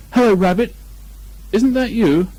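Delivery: high, loud bear voice; Pooh calling. Acted. Pooh calls into the hole again, high and loud.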